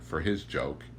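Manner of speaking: usual speaking voice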